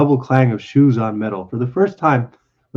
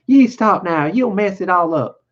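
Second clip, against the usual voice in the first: high, raised woman's protest; angry cry; 6 semitones higher than usual. Acted. Wife scolds man stroking her hair, angry cry. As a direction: cry out crossly in a woman's pitch. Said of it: "then she cried angrily".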